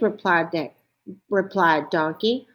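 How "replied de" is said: ordinary delivery, nothing marked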